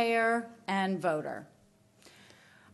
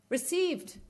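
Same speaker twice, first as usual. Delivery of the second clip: "Received." mocked in disbelief, much higher pitch; incredulous repeat; 10 semitones higher than usual. Real, not acted. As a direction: echo "Received." with scornful disbelief, pitched high. Scornful echo of the reply.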